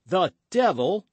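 loud, high indignant oath; sharp exclamation of anger; angry again. A narrator's reading. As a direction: burst out with renewed temper. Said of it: "becoming angry again".

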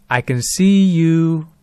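slow, drawn-out eerie taunt; mysterious caller voice. Retold or imitated. Voice on the phone watching the friends, slow and eerie. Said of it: "then the voice says".

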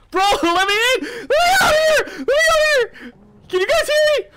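very high, wailing pleas; panicked screaming. Real, not acted. Player locked out calling friends, panicked screaming. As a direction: scream to be let in, voice way up. Shut out, begging to get in.

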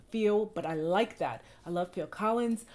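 ordinary talk

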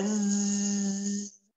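buzzing zzz like a passing fly. Shown as a demonstration. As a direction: imitate a fly with a long zzz. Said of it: "como cuando pasa la mosca".

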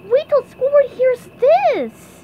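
loud, high, excited cartoon voice; SpongeBob. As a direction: squeal it with big news to share. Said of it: "said SpongeBob".

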